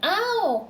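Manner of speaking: high, loud yelp of pain; cried out. A narrator's reading. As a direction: yelp as if your paw is being pulled. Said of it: "cried Pooh".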